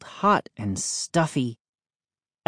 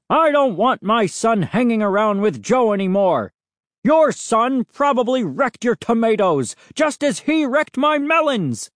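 high, heated accusation rising further; angry parting shot; 8 semitones higher, 3 dB louder than usual. A narrator's reading. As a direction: shout a final angry warning.

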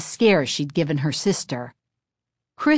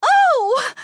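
sudden high gasp of fright; startled cry; 6 dB louder than usual. A narrator's reading. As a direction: cry out in shock. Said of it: "Chris cried out".

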